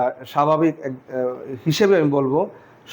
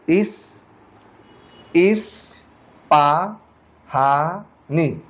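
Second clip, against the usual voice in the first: slow, split into syllables with pauses; much slower than usual. Shown as a demonstration. Teacher splitting Ispahani into syllables, slow and separated. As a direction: break the word into its syllables. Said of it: "এটাকে slow মোশনে আবার, reply করেন".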